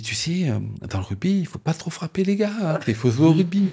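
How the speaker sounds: a light, teasing mimic of the wise coach; imitating Pierre Villepreux. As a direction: player mimics his coach gently scolding him. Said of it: "mais dit".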